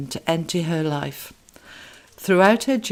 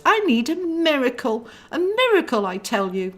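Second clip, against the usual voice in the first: much higher, urgent cry; desperate plea; 8 semitones higher than usual. Acted. Clarabelle begs her brother for help, high and desperate. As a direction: beg for a miracle.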